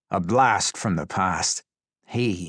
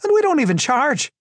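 loud, very high glee; mock-delighted high shout; exclaimed; 7 dB louder than usual. A narrator's reading. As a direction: crow the punchline. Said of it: "he exclaimed".

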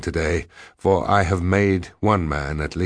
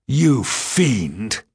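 higher, emphatic accusation; outraged cry; 5 semitones higher than usual. A narrator's reading. Diarist rounding on the woman, outraged cry. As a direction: hurl it at an enemy. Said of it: "I cried".

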